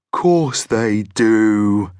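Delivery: very slow, drawn-out monster voice; Fuzby. A narrator's reading. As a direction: stretch out the agreement as a friendly monster. Monster agrees heartily with Joe.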